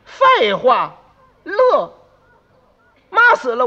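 loud, high offended retort; indignant outburst. Acted. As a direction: explode at an impertinent question. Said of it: "当时就掉脸儿". (掉脸儿、高声斥责)

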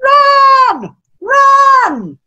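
high, loud drawn-out shout; long ringing cheer; cheering crowd. Acted. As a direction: bellow it like a stadium crowd. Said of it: "the crowd is going".

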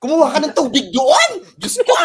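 mock yell. Exasperated dad yelling at his child to fetch water. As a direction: bark it like an exasperated parent.

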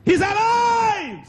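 very high, slow, loud cry of joy; jubilant shout. Retold or imitated. Preacher shouting for joy at the miracle. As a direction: shout it in triumph.

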